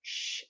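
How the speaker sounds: soft shh showing lip placement; shushing sound. Shown as a demonstration. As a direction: shush softly with rounded lips. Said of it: "see that".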